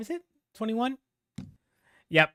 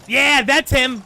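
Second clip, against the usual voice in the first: excited shout; 7 semitones higher, 8 dB louder, slower than usual. Real, not acted. Spots the striker in highlights.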